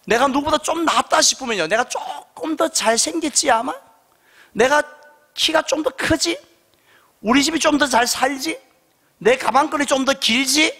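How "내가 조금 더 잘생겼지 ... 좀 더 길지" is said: smug self-praise. Retold or imitated. Imagined proud inner thoughts.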